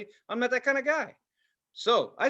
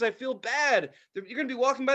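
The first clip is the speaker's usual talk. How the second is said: usual speaking voice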